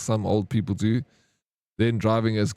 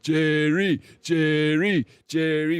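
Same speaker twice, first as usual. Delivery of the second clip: higher retold calling out, chanted; shouting Jerry; 5 semitones higher, much slower than usual. Bystander cheering the bar fight, higher mock chant of Jerry. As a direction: chant the name like egging on a fight. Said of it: "I just started shouting".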